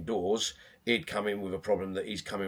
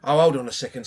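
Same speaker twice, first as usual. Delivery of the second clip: loud, bright "hold on a second"; mock surprise; 5 semitones higher, 10 dB louder than usual. Coach greeting a new kid, playful loud surprise. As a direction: stop short in playful surprise. Coach retelling his gym routine.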